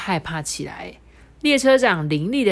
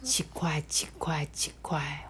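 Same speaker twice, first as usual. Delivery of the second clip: unvoiced fading whisper; whispered chugging; 3 semitones lower, 9 dB quieter than usual. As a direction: whisper the train sounds away. Train sound fading.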